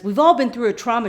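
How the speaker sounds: hammed-up quarrelling comeback; exasperated retort. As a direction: throw it back loudly. Acting Boone's retort in argument.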